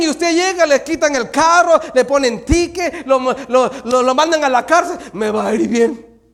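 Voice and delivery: high, shouted pulpit exhortation; loud, insistent sermon climax; forceful preaching. Real, not acted. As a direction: pile it up at full preaching volume.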